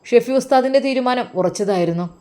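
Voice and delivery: ordinary delivery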